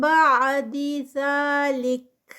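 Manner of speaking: slow, drawn-out tajweed recitation of بعد ذالک; Quran recitation. Shown as a demonstration. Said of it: "اور ذالک، ذالکا نہیں".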